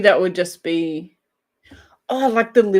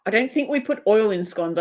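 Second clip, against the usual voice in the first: ordinary delivery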